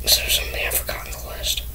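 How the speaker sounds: fast unvoiced whisper to himself. Real, not acted. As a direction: whisper it quickly while checking the list. Checking the list to himself.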